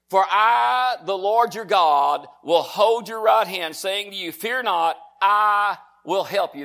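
slow, emphatic Bible reading from the pulpit; forceful scripture quotation; declaimed scripture. Acted. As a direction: proclaim it, stretching the key words.

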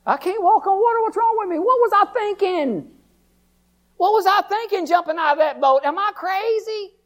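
high, strained doubting voice; doubting Peter. Acted. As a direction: panic rising as faith slips. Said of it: "he probably thought".

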